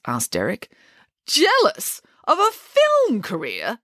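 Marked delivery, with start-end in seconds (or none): 1.3-3.8 very high, incredulous protest; indignant exclamation. A narrator's reading. Nanny Piggins denies being jealous, indignant exclamation. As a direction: exclaim it high, drawing out the second question. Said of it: "exclaimed Nanny Piggins".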